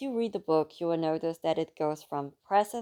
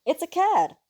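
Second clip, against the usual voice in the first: high, bright burst of joy; joyful exclamation; 13 semitones higher, 8 dB louder than usual. A narrator's reading. Luca showing off the found animal, joyful exclamation. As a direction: blurt it out delighted. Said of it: "he exclaimed joyfully".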